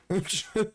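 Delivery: a quick high scoff of a laugh; a mocking laugh at his ex's threat; short scoffing laugh. Real, not acted. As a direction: laugh it off in disbelief.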